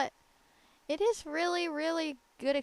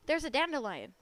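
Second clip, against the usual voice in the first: normal talking voice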